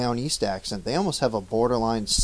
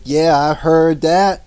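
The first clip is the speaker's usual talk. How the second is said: slow, louder put-on jive voice; awkward slangy drawl, higher and louder; jive talk; 6 semitones higher, 10 dB louder, slower than usual. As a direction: slip into a forced hip slang voice. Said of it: "why I'm talking like this right now".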